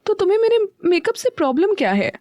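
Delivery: high irritated retort; annoyed wife, pitched well up. Acted. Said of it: "चिढ़कर कहा".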